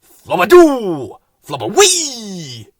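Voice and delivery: slow, loud, high nonsense calls; sing-song the nonsense words as you throw; Flowerpot gibberish. A narrator's reading. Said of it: "went Bill".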